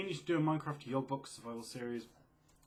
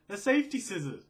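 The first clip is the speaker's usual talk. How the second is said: higher and louder in disbelief; incredulous exclaim; 8 semitones higher, 9 dB louder than usual. Real, not acted. Explaining a finger injury from safety scissors, incredulous and loud. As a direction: insist it like it makes no sense.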